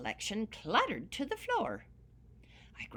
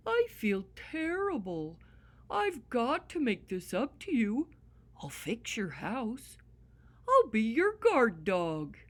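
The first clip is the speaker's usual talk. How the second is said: dog promising to guard the leprechaun's home; earnest dog voice; 3 semitones higher, 3 dB louder than usual. Acted. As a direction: make amends like an eager puppy. Dog voice offering help.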